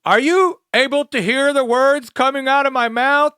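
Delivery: loud, high-pitched mock yell; mock shouting. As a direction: holler it as if to someone hard of hearing. Asking a supposedly deaf person, exaggerated.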